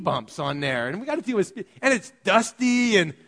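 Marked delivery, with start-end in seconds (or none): none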